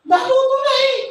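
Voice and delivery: put-on high, startled old man; surprised father. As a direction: pitch it way up in surprise. Isaac tells Esau it's cooked.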